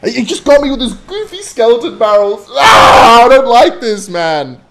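loud high exasperated rant; frustrated rant. Real, not acted. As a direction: rant about losing, loud. Ranting after losing.

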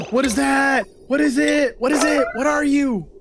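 gasp, then rapid-fire high-pitched questions; high, astonished repeated questioning; startled amazement. Real, not acted. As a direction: gasp at a sudden discovery and demand to know. Startled by unknown thing in game.

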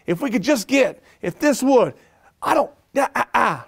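voce molto più alta, entusiasmo agitato e sconnesso; fired up, sputtering with excitement; fired-up rant. Retold or imitated. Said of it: "be like".

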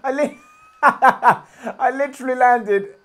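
slow and high through laughter; laughing incredulously. Real, not acted. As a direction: giggle it out in disbelief. Laughing at sudden attack.

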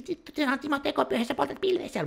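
mock whining. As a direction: whine like a petty hypocrite. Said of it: "joku nyt kitisee".

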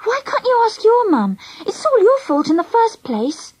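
high, indignant complaint; scowling protest. A narrator's reading. Friend blaming Angela, scowling and indignant. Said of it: "I scowled at her".

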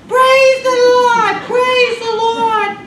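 put-on very high, loud, slow screeching old lady; shrill sung-out old woman praising; screeched high-pitched praise. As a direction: screech it out like an elderly church lady. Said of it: "screeched, high-pitched voice".

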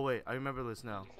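ordinary delivery

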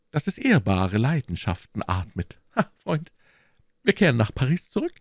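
high, eager, animated musketeer; quick, lifted character voice, excited; delighted musketeer. Acted. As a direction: rejoice at seeing your friends soon. Reader voices the delighted poet.

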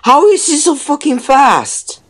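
high, loud exasperated yell; furious yell. Real, not acted. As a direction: yell it at the screen in rage. Repeated rant at enemy.

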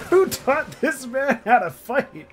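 laughing incredulous yell; incredulous shout. Real, not acted. Yelling in laughing disbelief.

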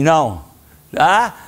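normal talking voice

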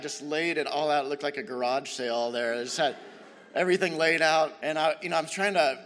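ordinary talk, nothing marked

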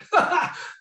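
a short, high, self-conscious laugh; real sheepish chuckle; embarrassed laugh. Real, not acted. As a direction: laugh off the awkward moment. Embarrassed after emotional moment.

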